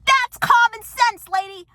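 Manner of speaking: high, loud, drawn-out outburst; exasperated shout. Acted. Vlogger answering the downstairs neighbor's note, exasperated shout. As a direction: yell it back at the complainer.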